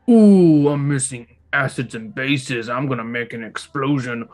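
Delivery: monkey hoots around caveman-style broken speech; hammed-up ape with loud oohs; silly monkey voice. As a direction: play a daft monkey scientist, hooting loudly between drawn-out phrases. Plays the monkey doing experiments.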